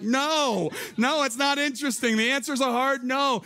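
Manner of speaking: high, ringing preacher's emphasis, pressing each no; fervent preaching. Acted. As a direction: drive the hard no home to the congregation. Pastor preaching firmly.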